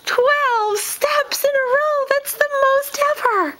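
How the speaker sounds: proud, drawn out. Real, not acted. Mother proud of twelve steps, high and slow. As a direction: stretch it out, beaming.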